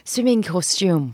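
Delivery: slow British-style "swimming costume"; British costume, drawn out; British pronunciation. Shown as a demonstration. Showing friends' puzzle over British dad's words, slow British accent. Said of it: "a British dad, I grew up saying".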